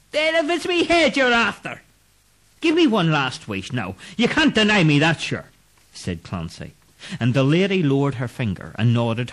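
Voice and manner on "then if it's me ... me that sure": higher wheedling voice; bargaining Irishman. Acted. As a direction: coax a last wish. Clancy bargains.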